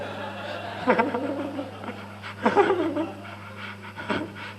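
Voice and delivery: bright high-pitched laugh; speaker's own laugh at his joke. Real, not acted. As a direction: let a high laugh burst out.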